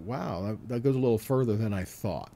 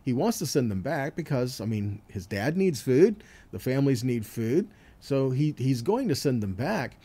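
ordinary talk, nothing marked

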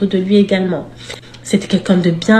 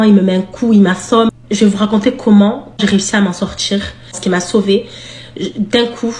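ordinary talk

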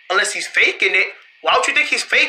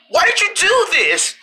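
ordinary talk

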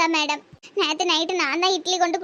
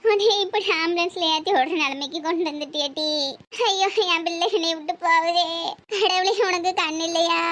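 distraught, tearful wail; wailing lament. Acted. Mother wailing as her daughter is taken away, wailing lament. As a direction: cry out in grief, words drawn into sobs.

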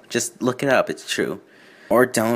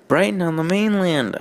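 put-on voice; 9 semitones higher than usual. Twilight replying in character.

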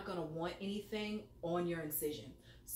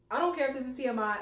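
excited; 7 semitones higher, 8 dB louder, much faster than usual. Real, not acted. Blurting out candidly.

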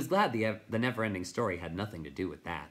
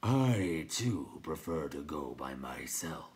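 crackling rock voice; 4 semitones lower, slower than usual. A narrator's reading. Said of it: "he crackled in the darkness".

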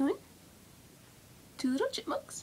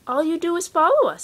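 higher, louder know-it-all chipmunk; bossy little brother; 5 semitones higher, 9 dB louder than usual. Acted. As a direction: explain it like it's obvious. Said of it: "said the brother".

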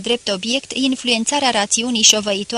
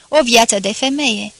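plain reading voice

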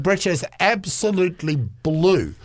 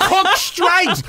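laughing, louder; 12 semitones higher, 4 dB louder than usual. Real, not acted. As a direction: giggle through the name. Repeats joke while laughing.

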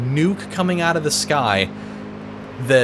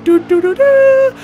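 loud, high fanfare noise; mock game-show horn blast; imitated victory jingle; 8 dB louder than usual. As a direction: toot the silly horn tune, last note rising high. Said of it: "kept doing that stupid ass".

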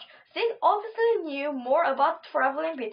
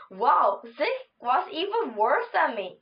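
ordinary delivery

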